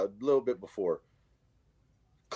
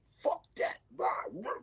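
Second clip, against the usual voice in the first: voiceless, rough mock outburst; imitated rant; 6 dB quieter than usual. Neighborhood kid rants to himself, mimicked voicelessly. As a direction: mimic a rant under your breath, no voice. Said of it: "this kid is having, like, full".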